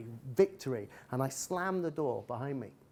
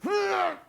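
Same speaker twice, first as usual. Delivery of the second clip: put-on angry toddler grunt; 6 dB louder than usual. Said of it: "she went".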